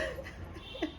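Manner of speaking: airy giggle under the breath; soft unvoiced chuckle; breathy laugh. Real, not acted. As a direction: let a quiet laugh slip out. Laughs after joke.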